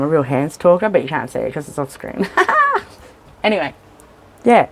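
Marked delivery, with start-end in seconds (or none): 2.3-2.8 burst of laughter. Real, not acted. Laughing at herself.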